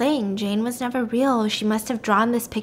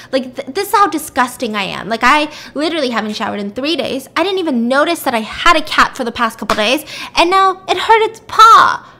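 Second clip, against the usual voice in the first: self-disgusted rant; 3 semitones higher, 7 dB louder than usual. Retold or imitated. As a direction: berate yourself, louder as it builds. Acted self-disgusted rant over cat.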